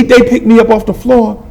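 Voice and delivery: ordinary delivery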